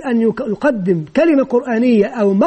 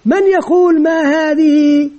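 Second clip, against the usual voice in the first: drawn-out questioning, slightly raised pitch; slow, pitched-up teacher prompt; teacher's class question; 4 semitones higher, 3 dB louder, slower than usual. Shown as a demonstration. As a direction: ask the class slowly, stretching the last word. Teacher modelling questions to pupils.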